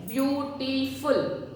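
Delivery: "beautiful" drawn out slowly as beau-ti-ful, every syllable clear; slow drilled word. Shown as a demonstration. Teacher drilling the class, saying "beautiful" slowly. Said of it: "What it is?".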